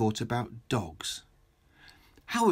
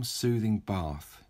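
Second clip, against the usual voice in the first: ordinary delivery, nothing marked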